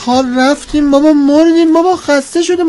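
put-on comic grumbling old man, pitch climbing; whining about heat. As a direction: moan to the host that the heat is killing you. Character whines about the heat.

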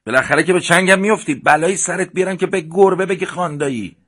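high, loud and fast threat yelled across the park; furious shout. Acted. Babak yells at Amir across the park, furious shout. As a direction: scream the threat after the fleeing boy. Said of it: "فریاد کشید".

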